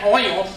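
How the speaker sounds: OIL overdone as "OY-ul"; mock the two-syllable oy-ul in OIL; ridiculed pronunciation. Shown as a demonstration. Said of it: "you sound ridiculous".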